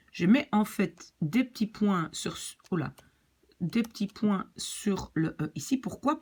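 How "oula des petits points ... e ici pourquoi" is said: ordinary talk, nothing marked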